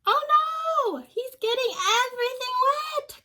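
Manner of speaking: high, loud alarmed cry; cried out. Acted. Olive cries out as rain soaks the party. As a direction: squeal it in dismay. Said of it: "cried Olive".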